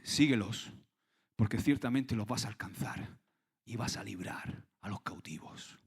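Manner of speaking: low, steady divine answer; calm voice of God. Acted. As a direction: speak as God, calm and sure.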